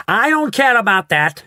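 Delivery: high, loud spoiled shout; bratty shout. A narrator's reading. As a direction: throw it back at the grown-ups. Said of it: "shouted Veruca".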